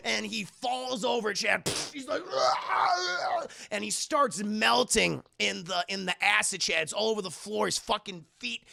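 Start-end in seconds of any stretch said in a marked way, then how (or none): 2.3-3.5 high strained cry of pain; agonized mock wail. Man falls into acid, high agonized mock wail. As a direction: wail like you fell in acid.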